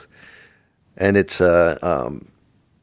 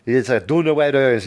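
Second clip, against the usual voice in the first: grandmother yelling in German; 6 semitones higher, slower than usual. Retold or imitated. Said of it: "my grandmother would run around".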